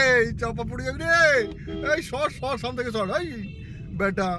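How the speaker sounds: high-pitched shooing call; shooing monkeys. Real, not acted. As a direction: chase them off the road.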